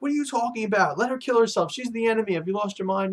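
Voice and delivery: high, mock-angry yell; mock yell at grandson. Grandfather berates Sai for saving the enemy, high mock yell. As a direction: scold him like a furious grandfather, lightly acted. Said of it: "Dong Jinzhao is yelling at him".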